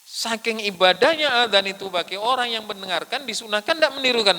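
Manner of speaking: high, loud sermon voice in parallel phrases; impassioned preaching. Real, not acted. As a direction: ring out each phrase to the congregation. Preaches at full force. (berkhotbah lantang dengan nada tinggi)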